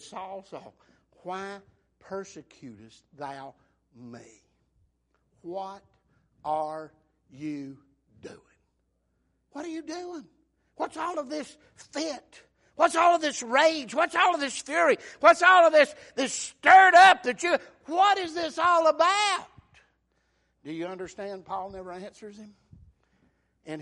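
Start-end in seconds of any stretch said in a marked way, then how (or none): 0.0-8.4 voice of the Lord. Acted. God's voice speaks to Saul. 9.5-19.5 high questions growing louder into a shout; building preacher's cry. Acted. Preacher voicing God rebuking Paul's rage, rising to a loud shout.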